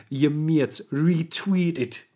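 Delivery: slow, practised Geordie accent. Shown as a demonstration. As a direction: draw out the vowels in a Geordie accent. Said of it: "that's the way you get the Geordie accent".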